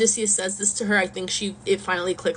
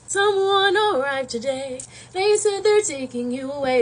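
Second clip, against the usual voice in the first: slow, higher, drawn-out mock lament; breaking news tearfully; 4 semitones higher than usual. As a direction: stretch it out like a hammed-up lament. Calypso breaks news, drawn-out acting.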